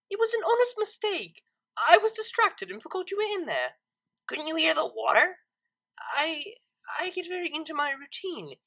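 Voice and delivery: high, embarrassed excuse; flustered high voice. Acted. Embarrassed Octavia explains herself.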